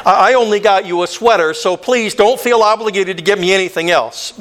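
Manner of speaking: usual speaking voice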